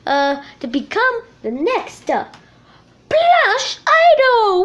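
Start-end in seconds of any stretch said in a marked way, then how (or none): 3.1-4.6 drawn-out show title. Pretend. Host announces the show title.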